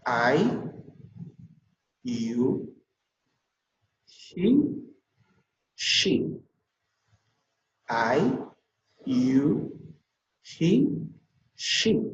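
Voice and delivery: each pronoun alone with pauses; pronunciation drill. Shown as a demonstration. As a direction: lift I, YOU and HE in a rising tune. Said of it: "pues vuelvo a repetir".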